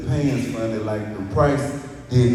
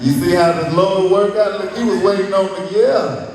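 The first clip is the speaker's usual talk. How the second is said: higher and louder, preacher-like praise; triumphant; 7 semitones higher, 6 dB louder than usual. Real, not acted. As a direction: testify it like good news.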